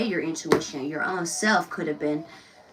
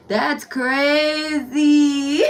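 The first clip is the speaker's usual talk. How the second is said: very slow, loud laughing shout; laughing disbelief; 6 semitones higher, 5 dB louder, much slower than usual. Real, not acted. Reader laughing loudly at the lyrics' story, slow.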